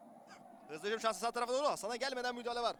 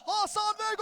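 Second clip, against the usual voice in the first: screaming football commentator; 7 semitones higher, 5 dB louder than usual. Real, not acted. Goal call.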